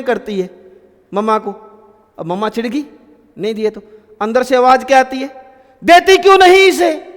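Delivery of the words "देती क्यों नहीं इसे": loud, high, sharp scolding shout; irritated mother yelling at the older daughter to share; mom scolding from inside. Acted. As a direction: snap at the daughter from the kitchen, क्यों hit hard. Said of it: "ऐसी आवाज़ आती है क्या?".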